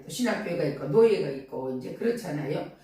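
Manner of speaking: ordinary talk, nothing marked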